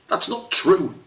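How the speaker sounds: put-on young squirrel snapping back in anger; a squirrel cub flaring up at his friend; angry shout. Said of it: "Squish shouted, suddenly angry".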